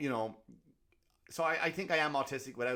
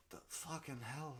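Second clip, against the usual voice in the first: muttered disgust; 3 semitones higher, 10 dB quieter, slower than usual. Retold or imitated. Restaurant diners muttering at the noisy pair, soft and slow. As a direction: mutter it under your breath. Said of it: "everyone ... going like".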